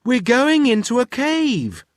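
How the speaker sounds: high, loud urgent warning; yelling. Acted. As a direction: yell at the professor about the cave. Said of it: "yelled Wilf".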